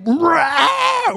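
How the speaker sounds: high screamed roar; psycho outburst. Acted. Sibling whose curling iron was stolen, going psycho with a roar. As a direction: explode in a mock-crazed scream. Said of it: "go psycho".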